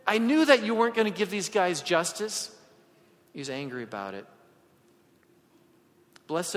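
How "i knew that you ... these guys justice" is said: upset complaint. Retold or imitated. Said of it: "angry and upset".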